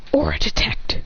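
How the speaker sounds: voiceless stage whisper; hushed villain incantation; whispered power call. Acted. Villain names his power.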